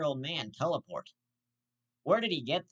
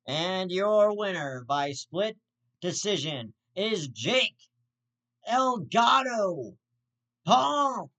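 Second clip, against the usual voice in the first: slow, loud, higher boxing announcer drawl; ring announcer; 7 semitones higher, 7 dB louder, much slower than usual. Retold or imitated. Mocking the fight decision, loud slow announcer voice. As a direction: stretch it out like a ring announcer calling the winner.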